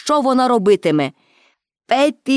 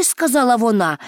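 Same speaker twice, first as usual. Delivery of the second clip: ordinary delivery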